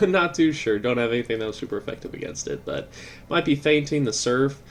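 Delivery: ordinary talk, nothing marked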